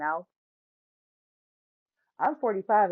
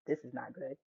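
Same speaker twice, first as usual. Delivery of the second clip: much softer, low and wary; inner dread; 7 semitones lower, 13 dB quieter than usual. Retold or imitated. Kids in the car dreading mom's return, softer and lower. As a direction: mutter the worry to yourself. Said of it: "I'm just like".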